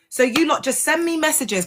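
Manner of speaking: ordinary talk, nothing marked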